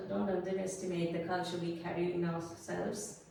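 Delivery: ordinary delivery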